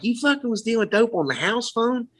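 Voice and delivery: retold scolding in a Southern accent. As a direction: imitate an incredulous Southern granddad. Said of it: "My granddad doesn't have a Southern accent".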